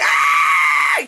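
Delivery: long loud scream; acted scream of pain; mock agonised scream. Imitating trapped woman.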